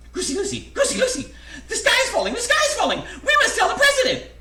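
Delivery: very high, loud bird shrieks; panicked yell. A narrator's reading. Frantic birds alerting their friend Goosey Lucy, high shrieking yell. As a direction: squawk the alarm at full pitch. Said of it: "ran to their friend Goosey Lucy and yelled".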